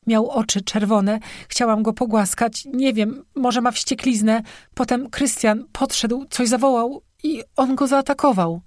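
high, distressed voice in fragments; acted tearful account, pitched well up; broken, sobbing sentences. A narrator's reading. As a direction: blurt it out haltingly, near tears. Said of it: "urywanymi zdaniami pomiędzy kolejnymi szlochami".

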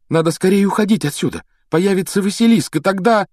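high-pitched, pressing warning; alarmed urging. A narrator's reading. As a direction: urge him to flee at once. Harry urges escape from basilisk. (тревожно, торопя)

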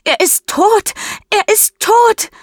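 very high, loud wailing shout; a desperate shrieking wail; crying and screaming. Acted. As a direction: scream it through tears. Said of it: "Mischa weint und schreit".

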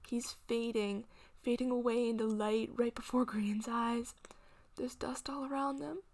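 plain reading voice

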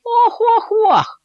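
высокий громкий рык котёнка; рычать как можно свирепее, тонким голосом; свирепое рычание котёнка. A narrator's reading. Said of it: "зарычал как можно свирепее". (котёнок изображает свирепого зверя)

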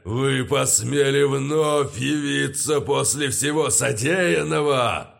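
roaring undead voice. Acted. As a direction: roar it like an ancient lich. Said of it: "за могильным голосом невероятной силы взревел".

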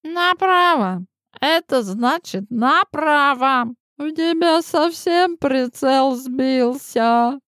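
сварливо постанывая, медленно; ворчливо простони; стонет. Acted. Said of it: "простонала Адольфовна". (стонущая старуха)

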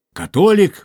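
a sharp high cry of surprise; surprised exclamation. A narrator's reading. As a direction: exclaim it, caught off guard, pitched high. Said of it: "воскликнул Бевиль". (удивлённый высокий возглас)

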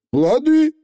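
very high, quick chirp; breezy Feegle agreement. A narrator's reading. Feegle promising the witch, high and light. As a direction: agree far too easily. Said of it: "легко согласился Явор заядло".

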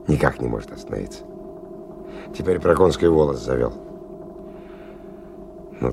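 plain reading voice

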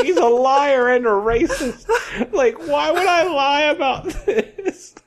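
high, laughing mock indignation; hammed-up protest, cracking up with laughter; mock accusers squabbling. Two workers arguing before the boss, mock indignation.

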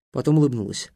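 plain reading voice

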